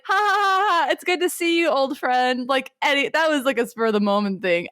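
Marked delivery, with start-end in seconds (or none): 0.1-2.5 high, put-on jovial laugh into greeting; Shanks. Shanks greets his angry old friend, with a high mock-jolly laugh. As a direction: breezily laugh it off, hammed up. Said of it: "Shanks is like".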